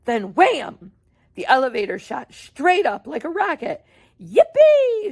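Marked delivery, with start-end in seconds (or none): 4.2-5.1 high, loud whoop of glee; joyful shout. Acted. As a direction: whoop like a kid on a ride. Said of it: "shouted Grandpa Joe".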